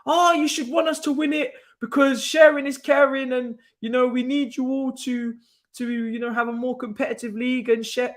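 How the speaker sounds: high, whiny mock of rival fans; mocking Arsenal fans. Fan mocking Arsenal supporters in a high whiny imitation. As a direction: sneer it out like a pleading rival fan. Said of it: "Arsenal fans trying to manipulate".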